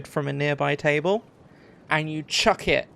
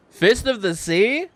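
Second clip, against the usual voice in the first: higher, louder incredulous sneer; mocking question; 7 semitones higher, 8 dB louder than usual. Graggins mocking the kid's challenge, loud scornful question. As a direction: throw the challenge back in scorn.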